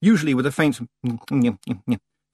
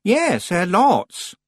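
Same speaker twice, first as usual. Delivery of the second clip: put-on slow high girl voice; 10 semitones higher, 5 dB louder, much slower than usual. Anagramma answers nervously.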